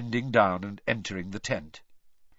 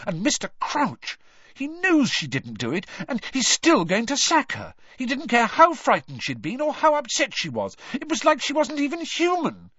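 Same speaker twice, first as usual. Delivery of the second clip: indignant rant climbing much higher; furious; 12 semitones higher, 4 dB louder than usual. A narrator's reading. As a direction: build from annoyance to high-pitched outrage. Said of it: "said Hermione furiously".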